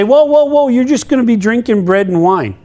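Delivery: high, alarmed whoa whoa whoa; mock alarmed protest. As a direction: play a flustered Jesus pulling back. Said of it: "Jesus didn't say".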